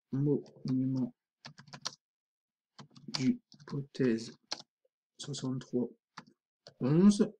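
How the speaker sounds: slow, quiet word-by-word dictation to oneself; typing aloud. Real, not acted. Teacher typing an image title for the tutorial, slow and quiet. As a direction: say each word softly as you type it.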